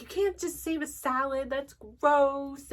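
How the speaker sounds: high, petulant retold whine; mock tantrum. Woman retelling her restaurant salad panic, mock tantrum whine. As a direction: replay the tantrum, half-hearted and whiny. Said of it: "having a full-on temper tantrum".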